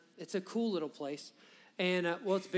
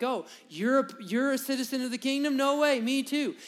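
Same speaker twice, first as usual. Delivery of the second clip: higher and louder, eager and delighted; excited; 6 semitones higher, 4 dB louder than usual. Retold or imitated. Pastor voicing a believer thrilled to meet another, excited. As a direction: gush like you just met a fellow citizen. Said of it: "you're excited because you're like".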